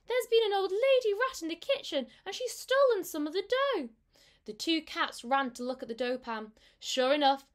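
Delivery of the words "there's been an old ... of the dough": high, loud panicky kitten voice; frightened kitten. A narrator's reading. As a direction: blurt it out like a scared kitten. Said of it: "said Moppet".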